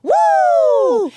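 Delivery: high shouted woo; cheering whoop. Real, not acted. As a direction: whoop it out for the school. School chant cheer.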